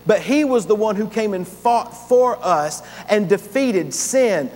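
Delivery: loud, driving sermon voice with hammered beats; full-force preaching. Acted. Pastor rousing the congregation about victory, preaching loud. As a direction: preach it out strong to the whole room.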